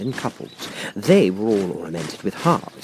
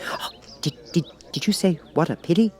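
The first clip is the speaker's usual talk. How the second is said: anxious Rabbit; 9 semitones higher than usual. A narrator's reading. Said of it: "peeping anxiously".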